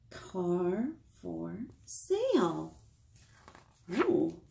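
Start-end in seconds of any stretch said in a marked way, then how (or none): none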